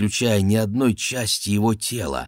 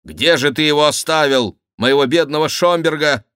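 higher, louder distressed question; alarmed demand; 7 semitones higher, 5 dB louder than usual. Acted. King asks where poor Schomberg was left, alarmed and raised. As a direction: demand it with raised voice, worried for a friend.

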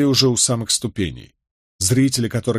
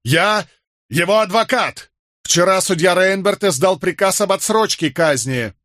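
urgent shout; 8 semitones higher than usual. A narrator's reading. Lawyer shouts to soldiers.

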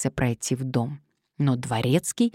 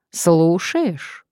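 tight, louder threatening question; menacing, through the teeth; 3 semitones higher, 5 dB louder than usual. A narrator's reading. Blackmailer on the phone to his victim, through his teeth. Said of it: "процедил он".

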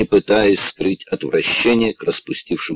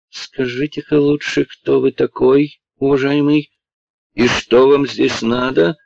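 hateful; 7 semitones higher than usual. A narrator's reading. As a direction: spit out every word with hate. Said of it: "спросил он с ненавистью". (с ненавистью, медленно и выше)

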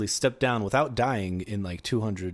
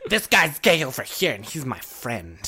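loud friend. Retold or imitated.

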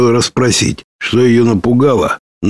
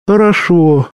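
put-on girl's voice; 9 semitones higher than usual. Lera agrees to sleep.

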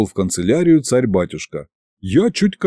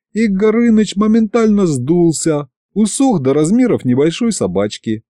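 usual speaking voice

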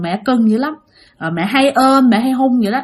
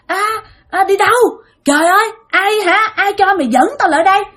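high, agitated cursing; sudden scolding outburst; 9 semitones higher than usual. Acted. Possessed mother refusing to enter fortune teller's house, angry high outburst. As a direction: protest and refuse in a high-pitched rant. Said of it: "tự nhiên mẹ chửi lên".

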